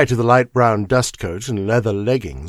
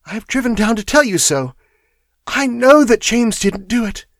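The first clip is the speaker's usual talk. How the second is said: much higher, urgent pleading; young woman, eager; 11 semitones higher, 5 dB louder than usual. Acted. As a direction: convince him James is innocent. Miss Turner voiced in story.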